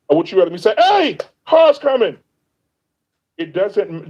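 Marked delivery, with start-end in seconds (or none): 0.8-2.2 higher, louder, slow alarmed yell; urgent warning shout. Retold or imitated. Warning a stranger in the road, urgent slow shout. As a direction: yell it like a car is about to hit them. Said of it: "the tone should not matter".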